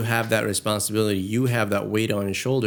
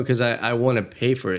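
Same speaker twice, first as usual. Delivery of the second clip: ordinary talk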